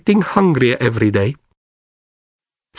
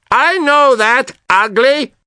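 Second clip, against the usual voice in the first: shouted; 12 semitones higher, 3 dB louder, slower than usual. Acted. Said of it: "I shouted to him".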